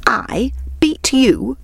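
high, slow, disbelieving question; incredulous exclamation. A narrator's reading. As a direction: repeat it back in scornful disbelief. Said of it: "exclaimed the hare".

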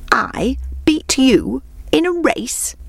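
high, drawn-out disbelief; incredulous exclaiming hare. A narrator's reading. Hare scoffing at the tortoise's challenge, incredulous exclamation. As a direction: exclaim it slowly in mock astonishment. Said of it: "exclaimed the hare".

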